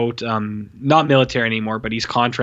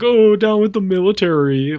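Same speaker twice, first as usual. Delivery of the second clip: high, louder mocking voice; mock protester; 7 semitones higher, 4 dB louder than usual. Reviewer mocking anti-military guys, high sarcastic voice. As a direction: parody an anti-military guy. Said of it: "one of those guys who's like".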